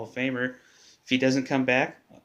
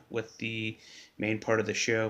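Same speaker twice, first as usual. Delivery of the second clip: ordinary talk, nothing marked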